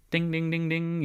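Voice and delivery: light chiming ding imitation; game-show ding. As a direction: ring out like a quiz bell. Game-show correct bell.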